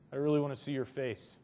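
soft, low, understated quote; unemotional. Shown as a demonstration. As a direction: say it quietly, with no feeling behind it. Shows the same words without emotion.

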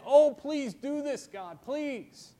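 high, drawn-out plea, easing off at the end; calling out in supplication; pleading prayer. Acted. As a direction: beg God earnestly. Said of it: "is this calling out to God".

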